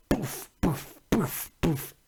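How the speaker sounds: soft, repeated mouth pops; puffed boof noises. Real, not acted. Host filling time on air, soft puffed noises. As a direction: puff out short quiet boofs.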